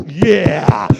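loud, drawn-out "yeah"; big cheering shout. Shown as a demonstration. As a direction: let out a freed-up church holler. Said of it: "you ought to just".

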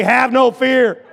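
loud, high, drawn-out rallying shout; with gusto. Shown as a demonstration. Pastor coaching a timid congregation, shouting with gusto. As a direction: belt it out slowly with gusto. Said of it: "say this with some gusto".

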